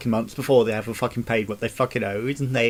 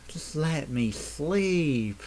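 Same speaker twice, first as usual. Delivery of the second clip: drawn-out plea; 3 semitones higher, 4 dB quieter, much slower than usual. Real, not acted. Exhausted plea for sleep.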